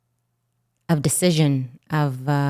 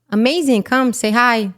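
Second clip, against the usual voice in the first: mock cheerful reply; 7 semitones higher, 4 dB louder than usual. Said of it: "And I was like".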